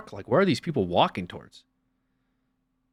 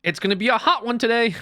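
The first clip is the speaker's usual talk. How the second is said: high-pitched chirpy small talk; mock morning person; 11 semitones higher, 3 dB louder than usual. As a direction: imitate an over-cheery early riser.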